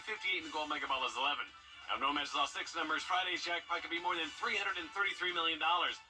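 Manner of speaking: ordinary delivery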